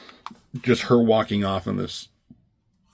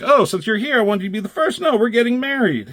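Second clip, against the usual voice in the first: higher voice for the woman; bright female character voice; 12 semitones higher, 3 dB louder, faster than usual. Retold or imitated. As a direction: play the happy bride-to-be. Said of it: "And he's like".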